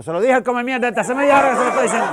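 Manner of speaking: high, loud, fast angry rant; furious outburst. Acted. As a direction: explode at the coordinator. Guy blows up at coordinator.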